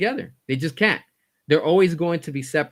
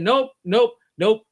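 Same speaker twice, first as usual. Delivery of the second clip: mock James Gunn refusal; 7 semitones higher, 6 dB louder, slower than usual. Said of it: "he said".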